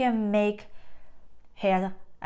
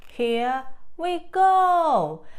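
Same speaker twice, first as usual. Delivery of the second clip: slow, loud, higher "Here we go"; drawn-out reveal; 6 semitones higher, 6 dB louder, much slower than usual. Real, not acted. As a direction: stretch it out for the reveal. Revealing signature.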